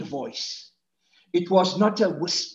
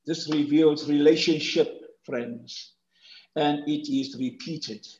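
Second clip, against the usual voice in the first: ordinary talk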